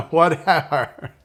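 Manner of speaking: slow, loud whatever through laughter; laughing whatever. Shown as a demonstration. Coach says the password while laughing, slow and loud. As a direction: laugh the word out. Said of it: "you can laugh while you say it".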